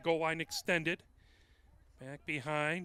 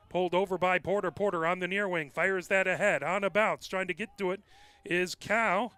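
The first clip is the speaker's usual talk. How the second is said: raised, louder fast play call; rapid hockey play-by-play; 3 semitones higher, 6 dB louder than usual. Acted. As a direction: keep pace with the rush up the field.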